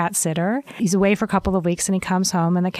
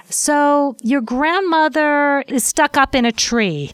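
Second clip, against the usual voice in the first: slow, higher, drawn-out delivery; careful news-breaking; 6 semitones higher, slower than usual. Acted. House sitter phones the owner with bad news, mock-cautious. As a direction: ease into bad news, stretching the words out.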